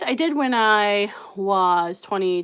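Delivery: normal talking voice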